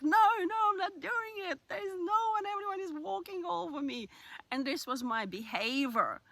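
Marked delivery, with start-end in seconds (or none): 0.0-4.1 much higher, louder, near-crying rant; tearful furious outburst, high and loud; retold raging outburst. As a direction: burst out as if at the end of your rope. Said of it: "I would be just raging and like".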